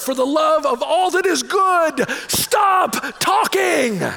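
high, slow, drawn-out pleading yell; exasperated inner shout. Retold or imitated. Inner voice to his own babbling self, exasperated shout. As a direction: beg yourself to stop, loud and stretched out.

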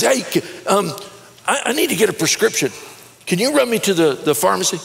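high, quick casual slacker ask; slacker asking a favor. Retold or imitated. Neighbor asking for a ride to the pharmacy, high casual voice.

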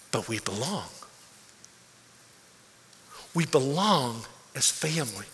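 soft, low, weighty stress on belong; quiet emphasis. Real, not acted. Pastor assuring the church they belong, soft and low. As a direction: let "belong" land softly and low.

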